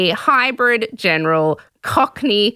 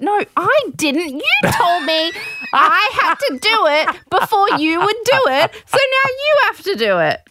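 high, insistent complaint; exasperated, rising insistence; indignant protest; 9 semitones higher than usual. Real, not acted. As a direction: argue back, pitched right up. Real mock-indignant protest to co-host.

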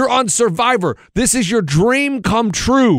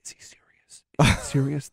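hushed disbelieving whisper; 6 semitones lower, 7 dB quieter than usual. Retold or imitated. Alliance members doubting him, whispering. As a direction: whisper it to your ally. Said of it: "are like".

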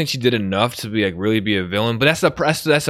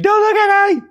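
very high, loud hammed-up squeal; mock flustered squeal; 5 dB louder than usual. Man retelling being caught crying by girlfriend, mock flustered squeal. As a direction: squeal it like someone caught crying, played for laughs.

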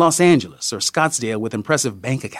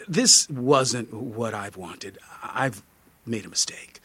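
soft, halting, lower voice trailing away; hesitant regret; 4 semitones lower, 6 dB quieter than usual. A narrator's reading. Tourist backs out of the vision quest, in soft, hesitant regret. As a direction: back out slowly and quietly, a touch apologetic.

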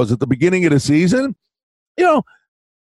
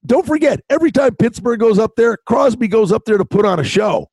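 normal talking voice

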